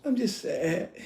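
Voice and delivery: soft, put-on pouty sadness; mock tearful sulk; fake tears. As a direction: fake being hurt to draw him in. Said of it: "See how she turned on the tears?".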